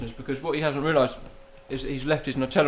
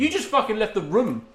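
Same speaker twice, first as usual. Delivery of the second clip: high, exasperated comeback; indignant retort; 8 semitones higher, 3 dB louder than usual. Real, not acted. Telling co-host off for leaving, indignant. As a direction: throw it back in mock outrage.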